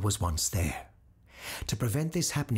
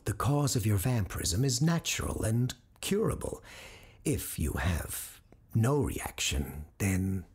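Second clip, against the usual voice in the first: ordinary delivery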